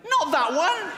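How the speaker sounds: very high, loud squawk of panic; horrified yell. Retold or imitated. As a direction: yelp it in mock horror at the wrong joke. Friend reacts in horror to joke.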